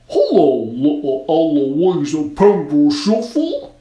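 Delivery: loud, slow snowman greeting; creepy snowman. A narrator's reading. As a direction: greet her as a sly snowman.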